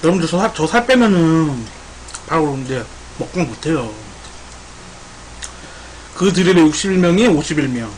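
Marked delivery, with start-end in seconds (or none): none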